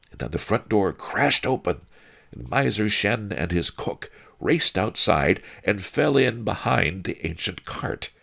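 ordinary delivery, nothing marked

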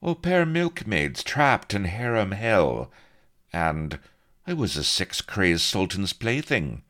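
breezy, uncaring dismissal; callous. A narrator's reading. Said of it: "He shrugged callously".

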